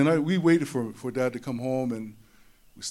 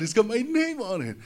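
much higher squeal on "name"; giddy boy; 9 semitones higher than usual. Retold or imitated. Little boy repeats it to himself, high and giddy. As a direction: up in the air with glee. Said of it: "oh, man, I was so excited".

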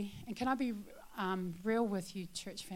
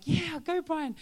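cheering; 7 semitones higher, 6 dB louder, slower than usual. Retold or imitated. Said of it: "were like".

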